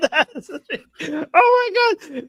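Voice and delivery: breaking into laughter; laughing disbelief. Real, not acted. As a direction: laugh through the words in disbelief.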